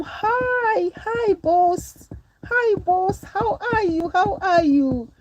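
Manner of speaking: high, bright-voiced gushing thanks to viewers; squealing thank-yous to the boss; excited thanks. Real, not acted. Thanking live-stream viewers for gifts.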